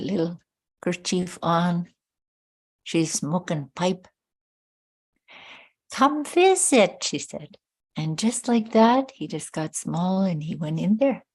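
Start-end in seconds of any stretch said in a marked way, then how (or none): 5.9-7.0 high, slow grandmotherly call; tiny old grandmother calling. A narrator's reading. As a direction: beckon him in like a tiny elder. Grandmother calls him in.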